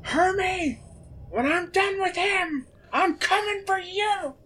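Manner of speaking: yelling threat. Acted. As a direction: yell it across the battlefield. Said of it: "Yukon yells out".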